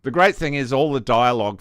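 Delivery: ordinary talk, nothing marked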